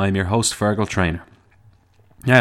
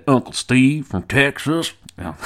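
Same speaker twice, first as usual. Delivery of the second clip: put-on Texan accent; 7 semitones higher, 3 dB louder than usual. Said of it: "doesn't even sound anything like you".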